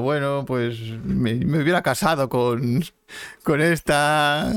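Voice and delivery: very slow, drawn-out musing; imitating Sam's lament. Co-host voices Sam facing death on the rock, wistful. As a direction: stretch the words as a wistful mock quote. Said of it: "el otro diciendo".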